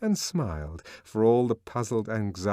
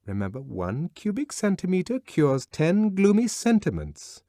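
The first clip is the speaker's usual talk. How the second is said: lightly voiced higher woman reciting; reciting a slogan; 9 semitones higher, 4 dB louder than usual. A narrator's reading. Lenina to Bernard, lightly reciting a slogan. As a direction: rattle off the jingle. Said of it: "sleep-taught wisdom".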